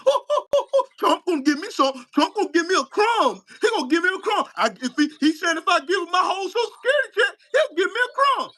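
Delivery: high, laughing mock voice of a gullible fan; sneering high-pitched parody of a fan begging for crumbs; mocking Trump fan. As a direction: ham up a begging, giddy Trump supporter.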